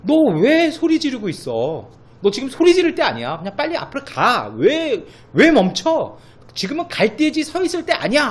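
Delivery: exasperated divine rebuke, loud and high; God rebuking Moses. Retold or imitated. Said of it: "이렇게 얘기하시는 게 아니라".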